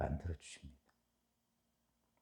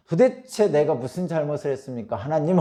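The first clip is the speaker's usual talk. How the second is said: raised, louder imitation of Job protesting; retold lament; 7 semitones higher, 7 dB louder than usual. Preacher voicing Job questioning God, loud retold lament. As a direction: plead upward as a lamenting Job. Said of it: "그러니까 이제 탄식이 나오죠".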